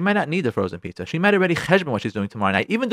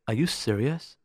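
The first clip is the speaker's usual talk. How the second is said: low, soft, deadpan question; quietly incredulous wife; unimpressed disbelief; 5 semitones lower, 9 dB quieter than usual. Acted. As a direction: drop low, unimpressed by the surprise.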